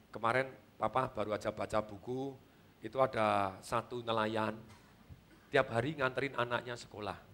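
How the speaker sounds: soft, low bedtime story voice to a son; father quietly telling his young son a story at bedtime; hushed storytelling. Acted. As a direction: tell it gently and quietly, drawing him in.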